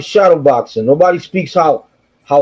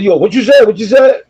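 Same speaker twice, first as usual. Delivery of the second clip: mock challenge; 4 semitones higher than usual. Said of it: "I thought I had beef with him".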